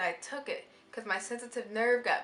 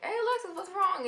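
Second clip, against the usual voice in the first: high-pitched imitation of a worried friend; squeaky mock concern; 11 semitones higher than usual. Friends looking over at her, a high mock-worried call.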